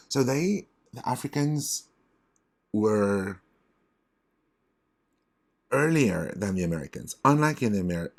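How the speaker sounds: emphatic excitement with halting starts; getting excited. Real, not acted. Teacher carried away explaining who had iron first, excited. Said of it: "I'm getting so excited".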